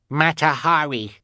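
put-on baby voice. A narrator's reading. Baby Sunny's voice.

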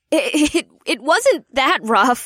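loud flustered blurt. Acted. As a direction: blurt it out, embarrassed. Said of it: "Janine blurted out".

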